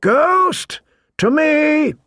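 high, carrying shout. A narrator's reading. Man summons his wolf in the woods, high shout. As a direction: call out at a very high pitch. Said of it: "he shouted".

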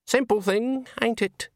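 put-on high-pitched old countrywoman; high, casual old-witch remark. A narrator's reading. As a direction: lift the voice high for a homely old woman. Granny speaks to Walter.

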